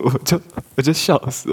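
ordinary delivery

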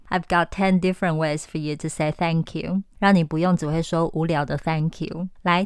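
ordinary talk, nothing marked

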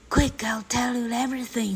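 high, breathy stage whisper; hushed, hurried plea of a storybook boy; urgent whisper. A narrator's reading. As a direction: whisper urgently through the door. Frightened boy whispers at the door.

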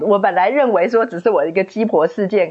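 ordinary talk

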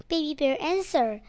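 usual speaking voice

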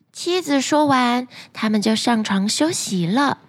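ordinary delivery, nothing marked

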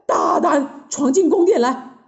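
startled, high and loud challenge; gasp, then yell at an intruder; alarmed king shouting; frightened shout. A narrator's reading. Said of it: "吓得大叫".

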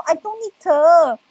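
slow, drawn-out mock "I don't need"; mocking English brush-off. Guest mocking the matchmaker's zeal with a slow sneer. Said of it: "你这个热心我真的不需要".

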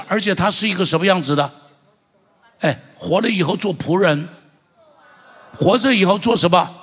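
loud, rising preacher's proclamation, he is King; preaching at full force. Acted. Pastor proclaiming Christ as King, loud and rousing. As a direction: call it out to the whole church. Preacher builds to a shouted climax.

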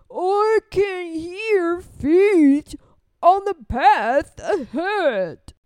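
very slow, loud monster voice; big Gruffalo. Acted. Gruffalo hears feet on the path, slow and booming. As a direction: drag each word out like a lumbering beast.

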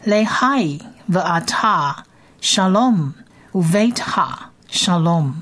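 teacher models each word at half pace; slow model reading. Shown as a demonstration. Teacher reads verse for listeners, slow model reading. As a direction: read the Hebrew slowly and clearly. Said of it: "我们先来念一遍".

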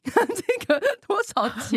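high, slow question through laughter; laughing while asking. Retold or imitated. Friend checking mansion prices while walking, laughing as she asks. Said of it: "说".

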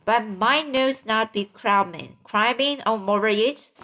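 ordinary delivery, nothing marked

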